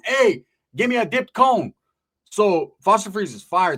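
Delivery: ordinary talk, nothing marked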